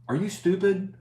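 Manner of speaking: high, scornful disbelief; incredulous retort. Retold or imitated. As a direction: scoff at the buyer as if he's mad. Mocking a lowball buyer.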